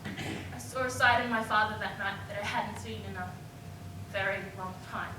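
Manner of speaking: low, soft, holding back tears; subdued realization. Acted. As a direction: sink down after the outburst. Subdued realization after the outburst.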